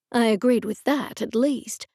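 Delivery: ordinary delivery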